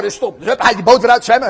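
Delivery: ordinary delivery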